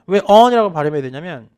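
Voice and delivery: normal talking voice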